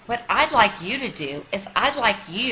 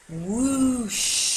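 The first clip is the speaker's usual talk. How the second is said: wind sound; 3 semitones higher than usual. Shown as a demonstration. Spelling the sound of the cold wind, whoosh. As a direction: blow it like the wind. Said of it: "the sound of the wind".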